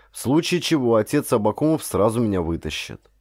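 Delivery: usual speaking voice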